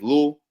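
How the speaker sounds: LUL in one syllable, no T; local pronunciation. Shown as a demonstration. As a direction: say little as a short lul. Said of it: "spell L O R. That means".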